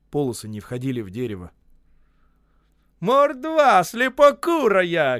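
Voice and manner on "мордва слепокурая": slow, high, loud bellow; furious shout. A narrator's reading. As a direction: roar the insult at the clumsy carpenters. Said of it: "кричал Осип".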